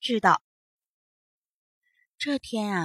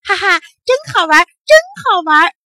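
loud, high, fast crowing; gleeful; 8 semitones higher, 11 dB louder than usual. A narrator's reading. Boy delighted at scaring the old monkey, gleefully. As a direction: laugh it out with naughty delight. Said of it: "好玩儿乐得直拍巴掌".

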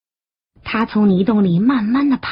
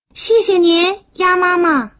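put-on high, childlike thanks; tadpole children; 7 semitones higher, 4 dB louder than usual. Tadpoles thanking Mother Duck, high and childlike. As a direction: pipe it up like polite little ones.

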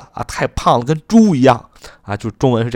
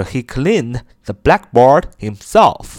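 slow example read; much slower than usual. Shown as a demonstration. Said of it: "你看，这边就用了反身代词".

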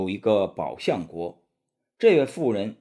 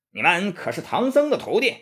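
shouted challenge; 8 semitones higher, 3 dB louder than usual. A narrator's reading. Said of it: "喝道".

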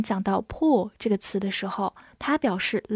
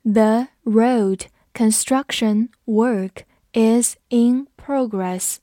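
very slow, each word set apart; slow reading; 3 dB louder, much slower than usual. Shown as a demonstration. Teacher reads the example slowly for students, slow reading. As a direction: read it slowly, pausing between words. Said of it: "我们慢读一遍".

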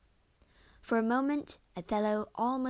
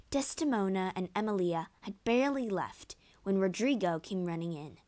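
usual speaking voice

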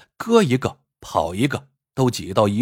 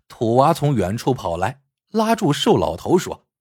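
ordinary delivery, nothing marked

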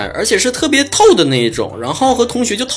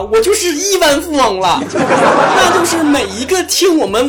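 giddy laughing boast; 8 semitones higher than usual. Real, not acted. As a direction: laugh through the brag. Giddy over his big milestone.

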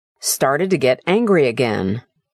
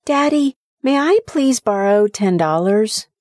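put-on higher, hopeful childlike request; small boy asking; 7 semitones higher than usual. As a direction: ask sweetly like a little boy. Son asks to borrow.

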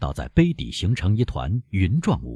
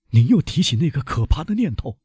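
higher, alarmed voice; fearful and aghast; 8 semitones higher than usual. A narrator's reading. Prosecutor horrified at the doctor's suspicion, alarmed. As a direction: recoil in horror as you say it. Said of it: "带着恐惧和惊愕的声调大声地说".

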